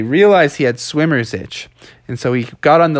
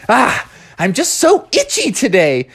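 high-pitched itchy whine; itchy complaint; 9 semitones higher than usual. Acted. Frog covered in swimmer's itch, high complaint. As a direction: complain in a higher voice, itching.

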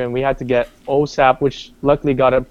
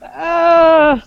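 a long, high drawn-out "ah" of hesitation; caller's drawn-out hesitant "ah"; 3 dB louder than usual. Retold or imitated. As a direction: stretch the "ah" up high like bad news is coming. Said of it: "he went like".

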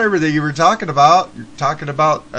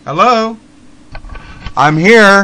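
loud, raised call down a bad line; real insistent calling into the phone; 4 semitones higher, 8 dB louder, slower than usual. Real, not acted. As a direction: make yourself heard on a dead connection. Calling over a dropped connection.

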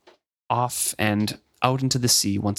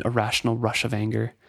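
usual speaking voice

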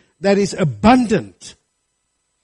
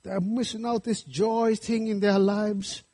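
put-on Welsh accent; 4 semitones higher, 5 dB quieter than usual. Preacher quoting a Welsh church moderator on joyless Christians, Welsh accent. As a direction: lilt it gently like a Welsh moderator. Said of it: "in his Welsh accent".

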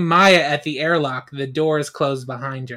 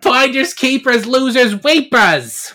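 teasing taunt; 8 semitones higher, 5 dB louder than usual. Acted. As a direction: gloat like a kid grabbing a prize. Damon teasing after stealing a shake.

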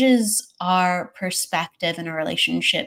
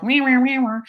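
higher-pitched mock meowing for bad energy; grumpy whiny meows; 6 semitones higher than usual. Describing our off-kilter energy after bad sleep, whiny mock meows. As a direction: imitate crabby energy as a run of meows. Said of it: "our energy is all like".

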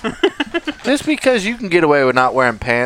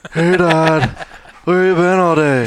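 a hint of a dorky teen movie character; lightly voiced dog as a peevish oddball; Napoleon Dynamite; slower than usual. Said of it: "The Great Dane is Napoleon Dynamite".

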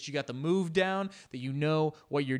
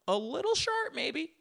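squeaky tease; 14 semitones higher than usual. Pretend. Teasing scared passenger.

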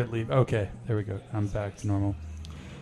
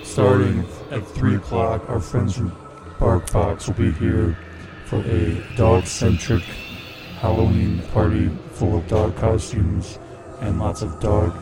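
spooky voice; 3 dB louder than usual. Pretend. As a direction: announce it like a possessed host. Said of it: "we're coming back to normal here".

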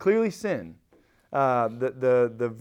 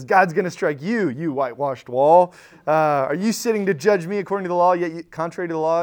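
loud, rebuking Paul voice in the retelling; retold angry yell; 3 semitones higher, 6 dB louder than usual. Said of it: "He yells at Ananias".

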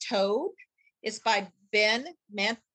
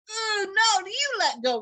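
high, loud whiny cry; a cartoon toad in a tug of war; protesting toad; 12 semitones higher, 4 dB louder than usual. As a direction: wail back at the frog to let go. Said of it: "replied the toad".

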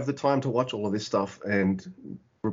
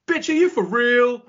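much higher, louder mock squeal of disbelief; imitating indignant disbelief; 13 semitones higher, 5 dB louder than usual. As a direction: parrot his outraged tone back at him. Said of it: "your tone of voice just now".

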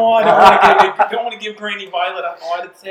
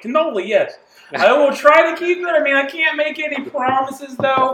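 put-on Italian accent, a bit louder; 6 semitones higher, 5 dB louder than usual. Player tries keeping Italian accent, Italian accent. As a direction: keep the Italian accent going. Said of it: "the accent".

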